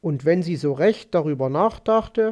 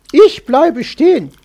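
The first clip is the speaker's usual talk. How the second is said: loud, raised, resolute refusal; firm voice; 6 semitones higher, 10 dB louder than usual. A narrator's reading. Magpie refusing the fox's offer of a seat, firm voice. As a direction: stand your ground firmly, louder and higher. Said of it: "sagte sie mit fester Stimme".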